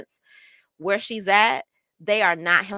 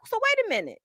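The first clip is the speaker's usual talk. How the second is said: incredulous retort; 13 semitones higher, faster than usual. Retold or imitated. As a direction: stop them mid-story in disbelief. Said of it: "I was like".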